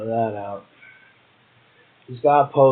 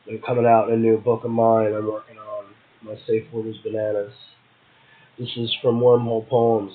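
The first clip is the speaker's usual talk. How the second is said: ordinary delivery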